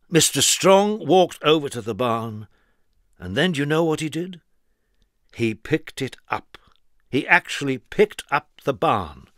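plain reading voice

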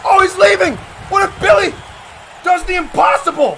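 high, loud wrestling commentary; excited shouting. Real, not acted. Commentator as the Machine leaves Billy, excited shouting.

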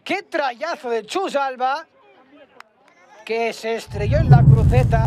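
high, loud crossbar call; excited shouting. Real, not acted. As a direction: shout the shot off the bar. Shot hits the crossbar.

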